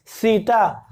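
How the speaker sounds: सीता with the tongue touching the teeth, dental s. Shown as a demonstration. Teacher models dental सीता for students, crisp dental s. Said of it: "शब्द में difference आप समझिएगा".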